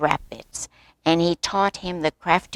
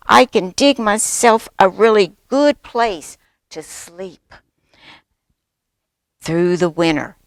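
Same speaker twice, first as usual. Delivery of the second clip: loud, raised, proud delivery; eager boasting cub; 7 dB louder than usual. A narrator's reading. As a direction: show off loudly like an excited youngster.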